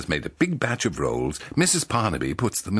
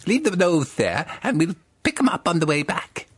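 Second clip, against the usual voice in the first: higher, louder helpful John; 10 semitones higher, 4 dB louder than usual. Acted. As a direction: play the eager shop helper, pitched up. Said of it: "John says".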